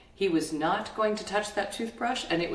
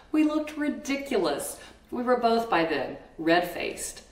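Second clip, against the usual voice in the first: ordinary talk, nothing marked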